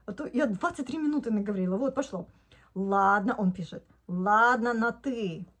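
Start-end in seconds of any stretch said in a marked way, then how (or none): none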